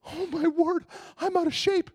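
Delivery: high, puffing after the run; winded exclamation, pitched high; mock out of breath. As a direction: gasp it out, winded from running. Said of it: "and I'm like".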